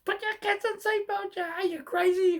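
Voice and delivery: scolding parents in a car over seatbelts, high yell; retold yelling. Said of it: "start yelling at them".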